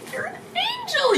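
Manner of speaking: starstruck gushing. Retold or imitated. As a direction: re-enact awe in a tiny high voice. Starstruck fan addressing her idol.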